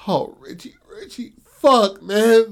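grief-struck outcry; anguished cry. Retold or imitated. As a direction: horror as the father realizes his son is dead. Father realizes he killed his son.

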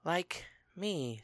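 soft, breathy-free hush of a secret; a quiet little confession; whispered. A narrator's reading. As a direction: say it under your breath, turning away. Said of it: "she whispered".

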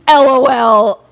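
loud, drawn-out "L O L"; hard LOL. Retold or imitated. Comedian reading a creepy fan message, hard LOL. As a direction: spell out L O L slowly and loudly. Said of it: "Did he say L O L like that? ... a hard L O L".

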